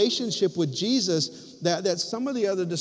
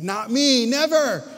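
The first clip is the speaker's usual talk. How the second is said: bold denial; 8 semitones higher, 6 dB louder than usual. Retold or imitated. Boastful Peter quoted by preacher.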